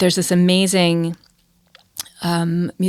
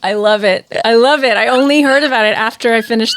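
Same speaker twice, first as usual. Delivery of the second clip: delighted; 5 semitones higher, 6 dB louder than usual. Real, not acted. Delighted by the meme's mention.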